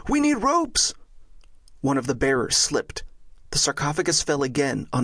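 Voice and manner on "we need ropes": high, urgent call for help; high-pitched cry, only modest volume; yell. Acted. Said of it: "Franz yelled".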